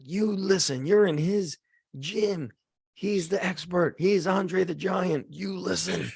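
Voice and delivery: high-pitched, emphatic mock lecture; yelling grandfather. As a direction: imitate a grandfather hammering home a scolding. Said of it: "my grandfather's yelling the whole way back".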